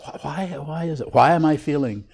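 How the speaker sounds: high-pitched circling questions; mock fretting. Teacher mimicking circular why questions to students, mock fretting.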